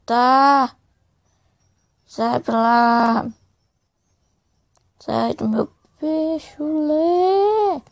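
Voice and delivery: panicked shouts at an attacker; frantic yelling. Real, not acted. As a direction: shout to drive him off. Fighting off attacker. (gritos de pânico)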